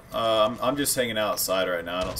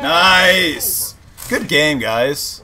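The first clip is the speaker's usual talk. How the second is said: excited cheer; 4 semitones higher, 7 dB louder, much slower than usual. Real, not acted. Match won.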